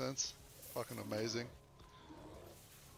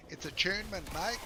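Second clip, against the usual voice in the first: "attunement, mate" high, in a hammed-up Aussie voice; mock Australian, "attunement" pitched up; playful Aussie "It's attunement, mate"; Australian accent; 7 semitones higher than usual. Shown as a demonstration. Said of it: "would you like me to say attunement".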